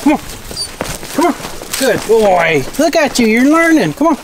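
high, bright puppy praise. Real, not acted.

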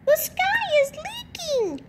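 squeaky chick voice crying the sky is leaking; panicky peep. A narrator's reading. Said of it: "she peeped".

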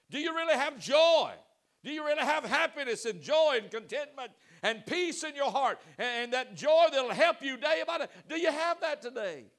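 loud rising preacher's call; preaching at full force. Real, not acted. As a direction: press the question hard on the congregation.

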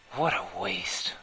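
slow whisper. Acted. Laura whispers sadly to the frozen head. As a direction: breathe it out, slow. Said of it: "Laura said to the uncomprehending head".

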